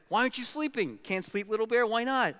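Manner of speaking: high, puzzled parent bear questioning; mock-exasperated, voice pitched well up; exasperated Big Bear. Big Bear to Little Bear who won't sleep, exasperated.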